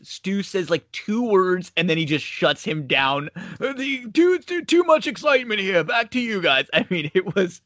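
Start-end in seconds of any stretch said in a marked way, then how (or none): 3.6-6.7 much higher, put-on pompous announcer; pompous announcer brushing off the interview, higher voice; mock announcer. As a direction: rush through it like a stuffy commentator. Quoting Alfred shutting Stu down.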